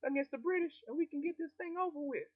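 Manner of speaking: very high, put-on voice of the queen; queen of China. The seized queen answering rebels, very high-pitched. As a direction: squeak it out as a prim queen giving in. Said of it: "and she said".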